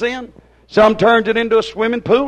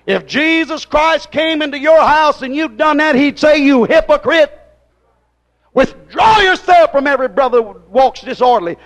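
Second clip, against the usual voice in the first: loud, raised rebuke from the pulpit; fiery preaching; 5 semitones higher, 4 dB louder than usual. Acted. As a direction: thunder it like a stern rebuke. Preaching loud, voicing Jesus rebuking.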